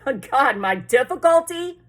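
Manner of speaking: loud, high incredulous question; exasperated disbelief. A narrator's reading. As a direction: throw the question back in desperate disbelief. Boy disbelieving the operator's question.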